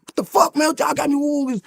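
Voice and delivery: retold outburst. To the guard waking him at night, high retold outrage. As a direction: replay losing it at the guard, high and exasperated. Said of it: "I, I lost it".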